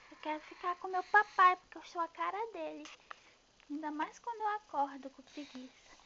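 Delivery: put-on high, loud, fast childlike plea; excited baby voice. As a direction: blurt it out eagerly as the baby. Baby voice pleading for papai.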